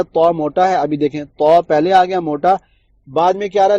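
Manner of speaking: ordinary talk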